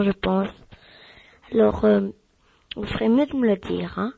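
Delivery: ordinary delivery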